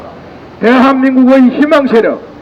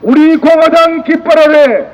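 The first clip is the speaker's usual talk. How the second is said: roaring rallying speech; 3 semitones higher than usual. Real, not acted. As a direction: build into a triumphant battle cry. Closing rally chant.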